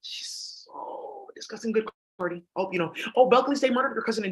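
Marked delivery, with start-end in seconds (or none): 0.0-1.7 slow, much softer gossiping whisper. Real, not acted. Speaker gossips to viewers in a slow whisper. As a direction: breathe it like juicy gossip.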